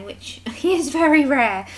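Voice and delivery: laughing as she says it. Real, not acted. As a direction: giggle through the words. Self-deprecating laugh.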